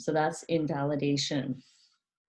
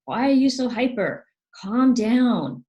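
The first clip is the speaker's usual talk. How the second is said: higher, louder, annoyed put-down; scolding parent; 8 semitones higher, 8 dB louder than usual. Retold or imitated. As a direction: shut down an excited kid. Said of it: "like, put down".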